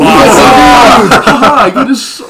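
high, loud, laughing echo; laughing mimicry. DM echoes player's joy.

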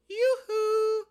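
very high, loud sing-song call of "Yoo-hoo"; high-pitched yoo-hoo. Shown as a demonstration. Advice-show host demonstrating a flirty call, very high and loud. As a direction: trill "Yoo-hoo" way up high to catch a man's eye. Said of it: "you're gonna say it in a higher pitched tone than I say it".

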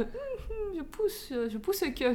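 high-pitched, mock straining; imitation de ses poussées. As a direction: act out tentative pushing, high and strained. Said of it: "au début, j'étais un peu". (petite voix aiguë qui mime la poussée)

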